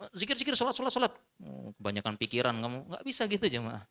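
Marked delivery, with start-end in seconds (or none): 0.1-1.1 preachy nagging. Retold or imitated. Menirukan nasihat yang menggurui.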